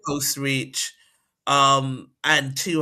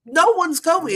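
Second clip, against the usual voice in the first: firm refusal; 11 semitones higher, 5 dB louder, slower than usual. Acted. Acting her firm parental refusal.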